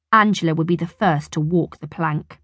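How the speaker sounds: ordinary delivery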